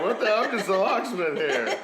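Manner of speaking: high, laughing disbelief; baffled. Retold or imitated. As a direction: protest in amused disbelief. Indignant confusion, laughing.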